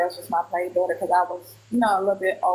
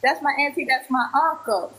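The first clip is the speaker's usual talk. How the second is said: higher-pitched imitation of a happy girl; retold smiling excitement; 6 semitones higher than usual. Girl happily pointing out her auntie and uncle, excited. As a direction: quote her with a smile, pitched up. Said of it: "had a smile on her face".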